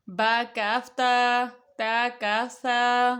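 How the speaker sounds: very slow, each letter held; slow recitation. Shown as a demonstration. Teacher to children reading the slate, very slow recitation. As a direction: chant the letters for the children to repeat. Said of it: "بچوں کو پہچان کے لیے مختلف شکلیں".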